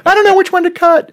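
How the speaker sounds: high, fast squeaky panic voice; mock panicked bomb defuser. Joking to the hosts as a panicked bomb defuser, squeaky and fast. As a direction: play a nervous defuser who can't choose a wire.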